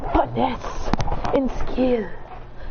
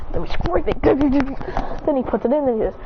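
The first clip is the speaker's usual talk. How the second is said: ordinary delivery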